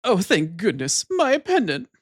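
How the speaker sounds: put-on high, drawn-out relieved girl voice; tomboy. Tomboy getting her pendant back, slow high relieved voice. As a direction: play a heroine's relief, pitched right up. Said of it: "let's go get the tomboy's pendant".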